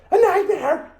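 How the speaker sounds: very high, squawking mock wail; comic high-pitched doom warning; mock horror. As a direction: exaggerate the dread in a squeaky high voice. Mock warning about skipping the practice.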